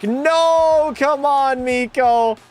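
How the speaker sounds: missed chance groan. Real, not acted. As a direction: cry out at the miss. Missed hat trick chance.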